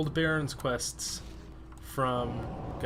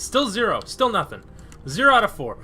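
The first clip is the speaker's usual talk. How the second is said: louder and louder frustrated counting; 6 semitones higher, 9 dB louder than usual. Real, not acted. Streamer grows louder and angrier over zero drops. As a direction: vent at the zero drops, building. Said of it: "I'm so mad right now".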